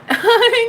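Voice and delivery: high loud laugh burst; real laugh. Real, not acted. Host amused by own affront, laughing high. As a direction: let out a quick high laugh. Laughs between mock takes.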